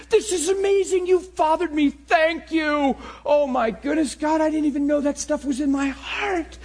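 high, loud cries of amazed gratitude; pumped up. Retold or imitated. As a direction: reenact overwhelmed thanks to God. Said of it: "I'm so pumped".

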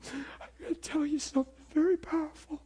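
very high, choked mock-tearful voice; imitated weeping. Friend reacting to his salvation story, imitated weeping. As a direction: act overcome, squeezing words through tears. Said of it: "he's just weeping and sobbing".

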